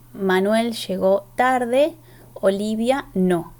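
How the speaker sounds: Manuel llegó tarde, Olivia no read slowly; slow example. Shown as a demonstration. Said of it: "ejemplo del predicado no verbal". (ejemplo leído despacio)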